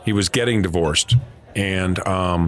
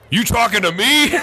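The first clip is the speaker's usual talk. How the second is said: gruff coach; 10 semitones higher than usual. Retold or imitated. Coach challenging the writer who interrupted, mock gruff. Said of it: "and Marty says".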